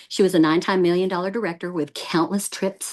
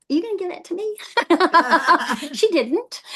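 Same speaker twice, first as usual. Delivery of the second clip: amused disbelief; 10 semitones higher than usual. Retold or imitated. Retelling her own shocked reaction.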